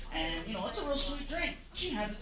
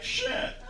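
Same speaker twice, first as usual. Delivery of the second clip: whispered curse with laugh; 5 dB louder than usual. Retold or imitated. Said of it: "he was like".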